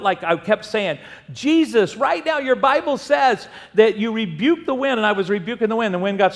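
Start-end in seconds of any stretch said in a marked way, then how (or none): 1.3-5.0 higher-pitched retold plea; urgent pleading. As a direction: press Jesus with his own promise. Retold desperate prayer in the storm.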